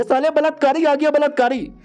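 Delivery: high shrieking cry of alarm; screaming accusation. Acted. Madam screams at the delivery boy, high shriek. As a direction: scream it the moment you see him. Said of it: "तो देखते ही चिल्ला देती है".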